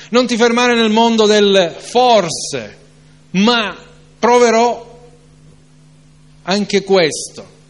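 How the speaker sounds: fervent preacher rebuking doubt; preaching at full force. Acted. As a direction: build the sermon to a passionate peak. Preacher's rising climax.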